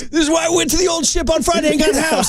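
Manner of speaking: high shouted parody of the emotional speech; hammed-up mock Mendoza. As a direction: yell it like the fired-up quarterback. Joining the parody.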